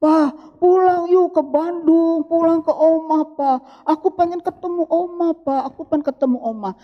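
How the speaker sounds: put-on whining child. Small child begging papa to visit grandma, imitated whine. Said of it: "dia nangis merengek".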